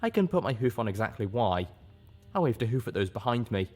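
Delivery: usual speaking voice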